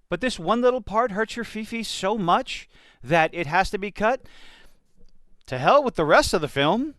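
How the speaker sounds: usual speaking voice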